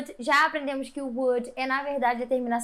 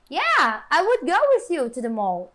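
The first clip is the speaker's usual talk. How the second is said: loud, upbeat "Yeah", then emphatic "I would go" falling to "mall"; affirmative intonation; 5 dB louder than usual. Shown as a demonstration. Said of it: "E quando você tá afirmando". (afirmação enfática, "Yeah" forte e "mall" descendente)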